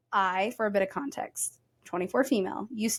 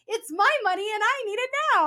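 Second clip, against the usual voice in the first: very high mock demand; hammed-up high commercial holler; commercial catchphrase yell; 11 semitones higher, 4 dB louder than usual. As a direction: belt out the old TV ad slogan. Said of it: "There's a commercial back in the day".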